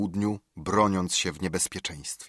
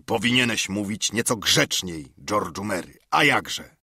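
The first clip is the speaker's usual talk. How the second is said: Silver berating. Acted.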